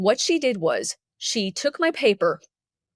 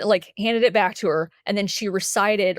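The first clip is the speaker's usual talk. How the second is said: ordinary talk, nothing marked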